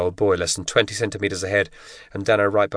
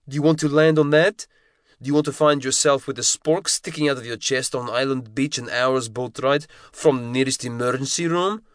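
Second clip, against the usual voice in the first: higher, tight scolding instructor's voice; giant Russian instructor, scolding; 6 semitones higher than usual. A narrator's reading. Instructor scolds ten-year-old Kevin over the spork, harsh scolding. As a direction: tear into the boy like a drill instructor.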